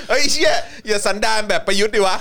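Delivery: high, loud laughing protest; real loud laughing scold; laughing outburst. Real, not acted. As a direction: crack up and protest through it. Cracks up at friend's claim.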